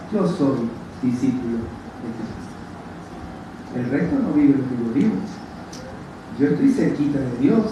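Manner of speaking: low, soft, self-satisfied mock voice; smug proud disciple. Preacher mocking proud disciples with a low, smug voice. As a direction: sneer it out like a spiritually proud disciple. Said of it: "Eran unos orgullosos espirituales".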